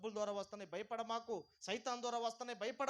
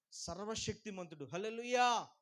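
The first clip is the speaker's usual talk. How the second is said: loud call and response, much louder; rally the crowd to shout praise; preaching at full force; 4 semitones higher, 15 dB louder than usual. Acted. Pastor rousing the congregation to praise, loud.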